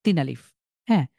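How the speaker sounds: ordinary talk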